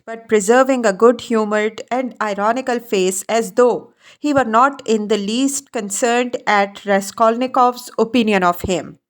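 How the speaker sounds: plain reading voice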